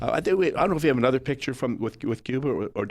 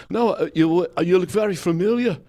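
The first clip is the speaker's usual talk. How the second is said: higher, louder quick insistence; 7 semitones higher, 6 dB louder than usual. Retold or imitated. [name] voiced in retold meeting.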